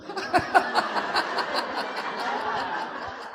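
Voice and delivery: airy, breathy real laugh after the punchline. Real, not acted. Wife laughing at her own courtship story, airy real laugh.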